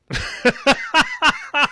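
high-pitched burst of acted laughter; mock laughter. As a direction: laugh in their faces as the mock court. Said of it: "so they laughed at him".

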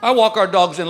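usual speaking voice

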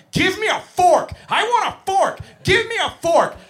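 put-on high, loud demanding toddler yell; screaming preschooler. Teacher mimics a kid yelling for a fork, high and loud. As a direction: shriek it like a three-year-old who wants a fork. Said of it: "These little kids just going".

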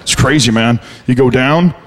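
low mock bro admiring a dull trip; dopey bro voice. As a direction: play a dim, impressed bro. Mocking dull adventurers in low voice.

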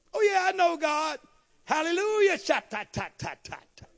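high, loud churchgoer imitation; hammed-up shout of false piety; mock zealous boasting. Mimicking showy professed believers.

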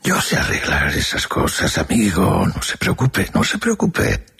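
high-pitched, would-be good-natured reassurance; forced bonhomie. A narrator's reading. Porter playing down the narrator's fears, in a would-be kindly tone. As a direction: reassure in an affectedly kindly, lifted voice. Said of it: "repetía con tono que quería ser bonachón".

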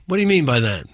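normal talking voice

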